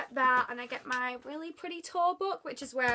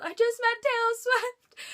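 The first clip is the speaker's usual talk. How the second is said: high, loud, drawn-out re-enacted scream; retold scream; 11 semitones higher, 7 dB louder than usual. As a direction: scream it out as you did at the arena. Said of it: "and then I scream".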